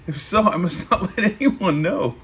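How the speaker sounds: words broken by a real laugh; losing it mid-sentence; giggling while reading; laughing through it. Real, not acted. Reader cracks up mid-quote.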